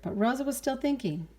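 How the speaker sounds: plain reading voice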